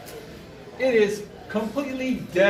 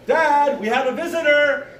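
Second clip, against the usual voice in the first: raised, high call upstairs; retold kid's holler; calling to Dad; 6 semitones higher, 4 dB louder than usual. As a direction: announce it urgently to a parent. Child calls up to father.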